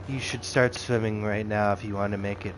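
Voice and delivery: lightly mock his bored, offhand tone; weird blasé voice. Mocking the Sith guy's blasé island talk, lightly low. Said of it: "he talks in, like, this weird voice too".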